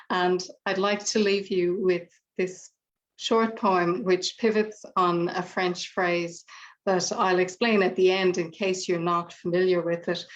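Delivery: ordinary delivery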